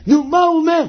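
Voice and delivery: high-pitched, expectant question; eager listening. Acted. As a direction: press impatiently to hear the words. Acts eager heavenly listening.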